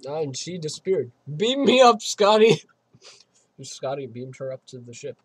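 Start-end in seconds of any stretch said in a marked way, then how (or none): 1.4-2.6 high, loud catchphrase call; mock shout. Player joking that a character vanished, mock shouted call. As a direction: holler it up to the ship.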